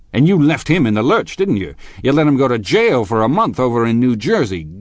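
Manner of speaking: loud, high, swooping attack; angry accusation. A narrator's reading. Tom accuses Gatsby of betraying a friend, angrily. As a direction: hammer him with scornful charges.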